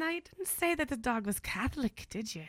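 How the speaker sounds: sing-song mock-Irish question; Irish accent. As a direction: deliver the joke's punchline in a put-on brogue. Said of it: "That's my favorite Irish joke ever".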